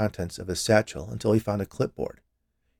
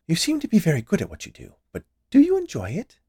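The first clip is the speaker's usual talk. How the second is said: high-pitched, inquisitive woman; prying confectioner; 9 semitones higher than usual. Acted. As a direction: press him with a high, lilting question. Confectioner questions the inspector.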